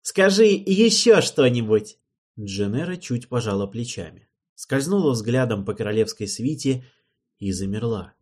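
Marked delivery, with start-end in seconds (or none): 0.0-2.0 loud, high, slow command to go on; eager king. Acted. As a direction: savour it, demand more. King egging her on.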